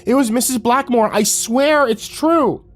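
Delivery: voice breaking. Acted. Boy insisting to his parents, voice breaking high. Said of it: "his voice broke on the last syllable".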